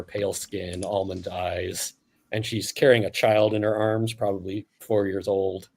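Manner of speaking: ordinary talk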